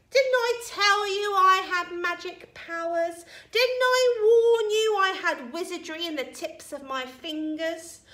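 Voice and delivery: high, loud, boastful grandma; triumphant shouting. A narrator's reading. Grandma boasting to George of magic powers, triumphant shouting.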